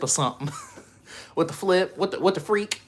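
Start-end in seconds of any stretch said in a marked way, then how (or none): 1.3-2.8 higher, playful minced oaths; goofy clean cussing; mock clean cusses. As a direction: swap the swear for a silly word. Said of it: "we gotta say".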